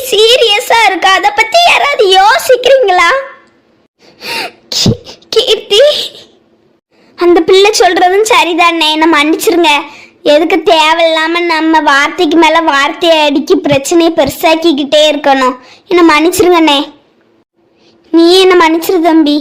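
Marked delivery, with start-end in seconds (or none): 4.8-5.8 choking sob. Acted. Woman breaks down over patient. 7.2-19.4 subdued apology. Acted.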